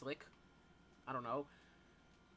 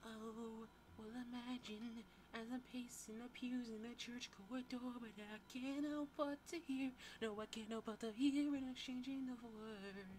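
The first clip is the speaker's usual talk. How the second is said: high, put-on gossip narrator voice; high theatrical narrator; storyteller voice; 8 semitones higher, 6 dB quieter than usual. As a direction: paint the church scene in a high, hammed-up voice. Sets scene in church voice.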